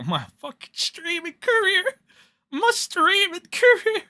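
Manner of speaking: high-pitched mock wail; whiny, put-on lament; mock despair. Mock despair over lost item.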